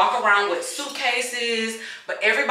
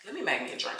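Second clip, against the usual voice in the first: ordinary talk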